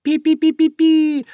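very high, loud repeated peeps; string of piercing imitation squeaks; terrified mouse squeaks. A narrator's reading. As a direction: squeak in panic like tiny mice. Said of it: "в ужасе запищали мышки".

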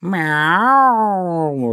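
long high loud meow; drawn-out, swooping imitation meow; cat's meow. A narrator's reading. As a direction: meow suddenly like a tomcat. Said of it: "раздалось вдруг".

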